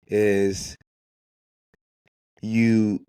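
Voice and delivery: usual speaking voice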